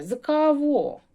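put-on high, loud, puzzled outsider voice with a Russian accent; mock Russian foreigner, loud; foreigner mishearing. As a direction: play a confused Russian asking back. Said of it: "Чужинець перепитає".

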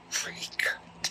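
breathy whispered exclamation. Real, not acted. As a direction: whisper it under your breath. Stifled reaction, husband sleeping.

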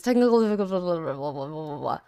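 low, soft mock announcer; deep announcer voice. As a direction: drop into a deep dramatic voice. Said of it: "low put-on repeat".

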